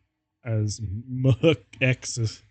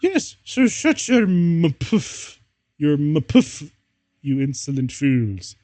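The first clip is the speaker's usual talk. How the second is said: hammed-up yelling professor; 2 semitones higher, slower than usual. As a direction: lord it over them like a villainous teacher. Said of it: "yelled Professor McGoggle".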